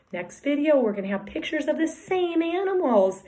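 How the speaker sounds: usual speaking voice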